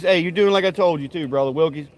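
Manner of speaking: ordinary delivery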